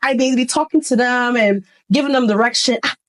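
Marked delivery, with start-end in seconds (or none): none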